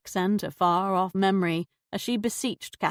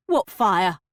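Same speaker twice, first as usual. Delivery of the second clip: startled, louder fake French; a caught-out faker, French slipping; alarmed put-on French; fake French accent; 6 dB louder than usual. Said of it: "fake French accent slipping".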